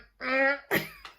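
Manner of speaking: high, loud mock wail; imitated embarrassed whine. Retelling hiding under the covers, high mock whine.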